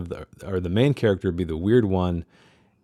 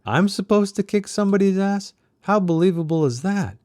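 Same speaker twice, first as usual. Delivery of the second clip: raised, strained character voice; high voice for Doc; 12 semitones higher than usual. Acted. Doc voiced in read novel.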